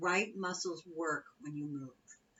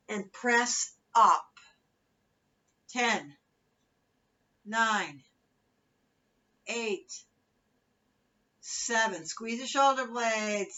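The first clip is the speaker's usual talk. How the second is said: aerobics instructor; 3 semitones higher, 7 dB louder, much slower than usual. Acted. Fitness cueing and countdown.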